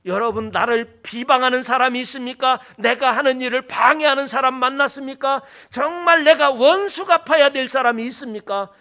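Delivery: loud, high rhetorical questions; insistent pulpit challenge, building; forceful preaching. Real, not acted. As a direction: press the congregation with each question.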